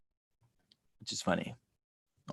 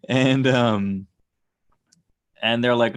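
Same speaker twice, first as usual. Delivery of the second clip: normal talking voice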